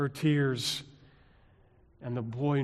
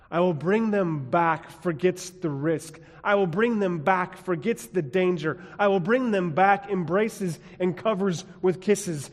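rising, louder preaching build; preaching refrain; 5 semitones higher, 5 dB louder than usual. Acted. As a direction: drive the refrain home, climbing.

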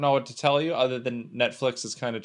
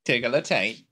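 mock British voice; British accent; 3 semitones lower than usual. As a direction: imitate a Brit for a joke. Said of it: "do it in a British voice".

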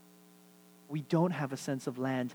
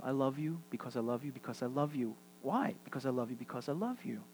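tender voice of God; 4 semitones lower, 12 dB quieter, faster than usual. Acted. Voicing God's tender love.

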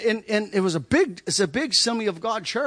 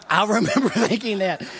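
said through laughter, louder; laughing; 7 dB louder than usual. Real, not acted. Preacher telling the congregation, laughing. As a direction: laugh while recalling it.

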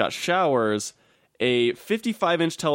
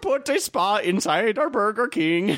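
high mock Finnish accent drifting Indian and German; badly faked Finnish accent, lost somewhere; fake Finnish accent; 9 semitones higher than usual. Announcing the Burger King spa again, in a muddled fake Finnish accent. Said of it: "between Indian and German".

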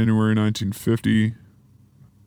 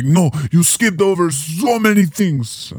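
higher, slow, hammed-up Russian accent; a bad Russian accent, drawn out and raised; mock Russian accent; 7 semitones higher, 3 dB louder than usual. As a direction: play a Russian listener complaining, in a put-on Russian accent. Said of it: "that's not my Russian accent".

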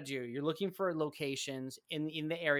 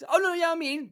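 high, loud flustered brush-off; mock-nervous high voice; flustered deflecting; 13 semitones higher, 9 dB louder than usual. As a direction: nervously wave off the worry, pitched up. Flustered Shoto deflecting concern.

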